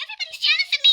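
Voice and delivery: very high-pitched mock self-important voice; mocking boast. Denying she goes around saying this, mocking boast. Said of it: "I'm not talking like that".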